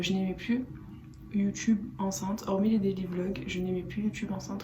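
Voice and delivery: ordinary delivery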